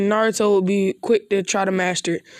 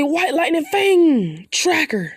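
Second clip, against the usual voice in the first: high, slow, drawn-out technique name; jutsu battle cry; 6 semitones higher, slower than usual. Acted. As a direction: unleash the finisher like an anime hero. Calling out the final jutsu.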